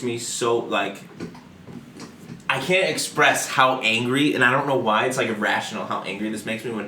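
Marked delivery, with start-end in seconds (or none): none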